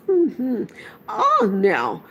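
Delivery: put-on old Ent humming and musing aloud; Treebeard. A narrator's reading. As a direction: voice the ancient tree-giant, grumbling. Treebeard voiced in story.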